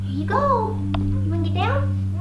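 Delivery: high puppy talk; talking to a dog. Real, not acted. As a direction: coax the puppy. Coaxing the puppy.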